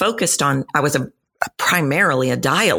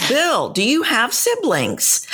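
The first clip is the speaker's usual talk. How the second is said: slow, higher, deliberate asking; pointed question; 8 semitones higher, slower than usual. Retold or imitated. Coach to client with a tiny voice, pointed questioning. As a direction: ask it slowly and knowingly, pitched up.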